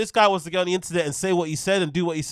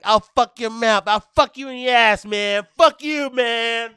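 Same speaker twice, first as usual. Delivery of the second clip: put-on higher, louder, shouting like an angry gamer kid; Call of Duty lobby kid; 5 semitones higher, 6 dB louder than usual. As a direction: yell like a raging teen on a game headset. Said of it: "He sounds like one of those kids on, like, a Call of Duty lobby".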